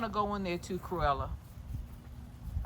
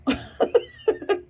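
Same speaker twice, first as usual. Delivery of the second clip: high laugh; 6 dB louder than usual. Real, not acted. Laughs at chickens.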